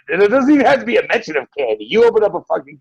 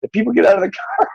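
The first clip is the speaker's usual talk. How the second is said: ordinary talk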